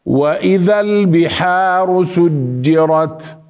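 slow recitation, سجرت with hard dj; recite, closing سجرت's jeem like d plus j; firm jeem. Shown as a demonstration. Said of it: "كأنها مدموجة بدال". (تلاوة سجرت بجيم كأنها مدموجة بدال)

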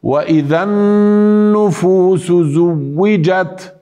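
slow chant, زوجت with doubled w; recite, pressing the waw in زوجت; recitation with shadda. Shown as a demonstration. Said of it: "يوجد على الواو شدة". (تلاوة بتشديد واو زوجت)

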